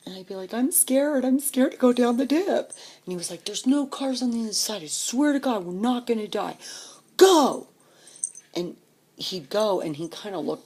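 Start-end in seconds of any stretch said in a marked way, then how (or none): 0.5-2.7 high, loud retold kid's whine of fear; scared child. Girl to brother at the dip, scared. 3.5-6.6 mildly hammed-up, raised-pitch kid coaxing; urging brother. Brother coaxing scared sister, urging. 7.2-7.7 high, very loud retold kid's yell; urging shout. Brother to sister at the dip, shouted order.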